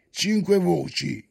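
plain reading voice

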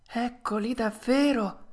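high startled puppet voice under breath. Acted. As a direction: gasp it to yourself, alarmed. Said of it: "disse dentro di sé".

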